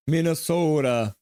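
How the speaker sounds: slow Minnesota accent with a long O. Shown as a demonstration. Streamer obliging a viewer's request, Minnesota accent. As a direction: stretch the O like a Minnesotan. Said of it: "say Minnesota with a Minnesota accent".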